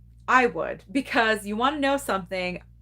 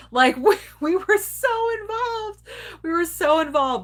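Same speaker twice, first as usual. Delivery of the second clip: laughing; 9 semitones higher, 4 dB louder than usual. Real, not acted. Laughing at own obsession.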